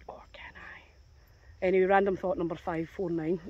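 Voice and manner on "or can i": slow, hushed, mischievous aside; sly whisper. Acted. To viewers, joking about taking the dead deer, a slow sly whisper. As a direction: let it hang as a slow whispered tease.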